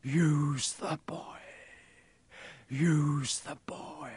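slow, drawn-out eerie voice; eerie answering voice. A narrator's reading. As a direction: draw out each word like a disembodied master. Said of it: "a voice answered".

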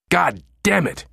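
high angry scream. Acted. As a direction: scream it in frustration. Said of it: "he screamed".

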